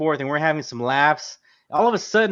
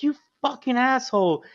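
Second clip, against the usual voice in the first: imitated angry accusation; 9 semitones higher, 4 dB louder than usual. Girlfriend blaming the narrator.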